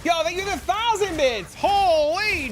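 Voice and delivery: high, loud excited thanks; hyped thanks. Real, not acted. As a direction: shout thanks in delight. Hyped thanks for thousand bits.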